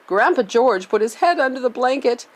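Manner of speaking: usual speaking voice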